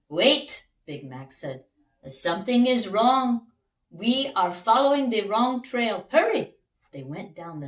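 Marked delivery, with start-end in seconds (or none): 0.1-0.7 a loud, high wait; sudden alarm. Acted. Big Max halts the king, loud and high. 2.1-6.5 louder and higher, rising to a high hurry; urgent alarm. Acted. Big Max urges the king to hurry, loud and high. Realizing wrong trail, urgent.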